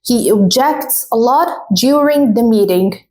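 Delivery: he obJECTs a lot, x0.5 slow; slow dictation; slow model sentence. Shown as a demonstration. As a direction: read it slowly, stress obJECTs. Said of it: "For example".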